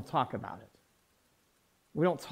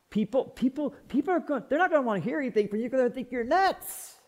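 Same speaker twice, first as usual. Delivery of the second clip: higher, stammering protest; worried objector; 8 semitones higher, faster than usual. Acted. Churchgoer warning the pastor nobody will listen, worried objection. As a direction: protest in a higher voice, tripping over the start.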